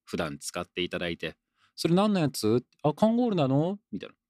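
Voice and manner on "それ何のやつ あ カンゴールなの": higher-pitched curious question; acted casual query, pitched up; curious questioner. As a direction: play someone asking about your shirt, voice lifted. Voices someone asking about the shirt.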